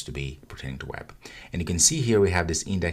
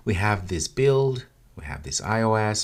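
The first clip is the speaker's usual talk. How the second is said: usual speaking voice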